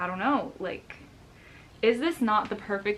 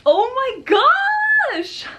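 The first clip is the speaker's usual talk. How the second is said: high, loud, drawn-out shout of delight; real overjoyed yell; ecstatic shout; 11 dB louder, much slower than usual. Real, not acted. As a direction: scream it out in joy. Sees the rare plant.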